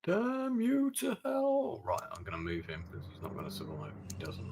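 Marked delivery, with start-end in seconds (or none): 0.0-1.8 high, slow hammed-up damnation; a theatrical mock curse at the enemy unit; mock villain curse. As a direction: curse him like a pantomime villain.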